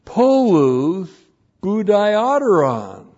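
"polus budioteron" called out high and loud; excited exclamation. Real, not acted. Preacher delighting in the Greek word for his hearers, excited. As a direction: burst out with "polus budioteron" as if thrilled.

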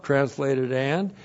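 usual speaking voice

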